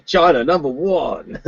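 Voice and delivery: hammed-up American accent, slow and proud; mock American voice. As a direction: ham up an American chant about China. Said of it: "it's a bit of an American thing".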